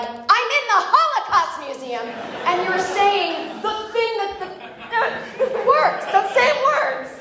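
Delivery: rising in exasperated pitch, slowing on the thing; high mock-outraged retort to the museum guide; incredulous outrage. Her outraged retort, retold.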